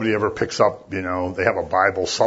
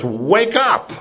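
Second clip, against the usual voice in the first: high, ringing call to wake; startling loud call; 9 semitones higher, 3 dB louder than usual. Retold or imitated. John the Baptist rousing sleepers with a startling call. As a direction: rouse the sleeping crowd. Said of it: "John's voice says".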